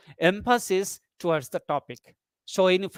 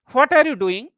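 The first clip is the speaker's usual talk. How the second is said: "What are you doing" higher and louder, as its own group; second tone group; 6 semitones higher, 6 dB louder than usual. Shown as a demonstration. Teacher singles out the second group, "What are you doing" said high and louder. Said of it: "This is second".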